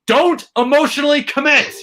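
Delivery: high, loud mock yell; sudden high shout of self-warning; yelled at himself. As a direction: snap it at yourself like a scolding. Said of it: "I'm yelling and cursing at the T V".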